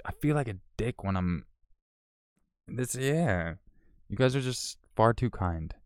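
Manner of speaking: usual speaking voice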